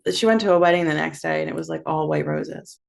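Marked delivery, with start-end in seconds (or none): none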